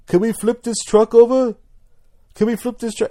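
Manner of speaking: high, put-on disguise voice; silly high-pitched troll voice; disguised accent voice. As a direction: pitch it way up to hide your real voice. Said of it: "he's using an accent 'cause he, he doesn't want, you know, his voice to leak".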